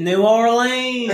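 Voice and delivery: "Orleans" drawn out high and loud; exaggerated pronunciation. Shown as a demonstration. Quizmaster teasing how the city is said, "Orleans" exaggerated and drawn out. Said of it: "Why do you say it like that?".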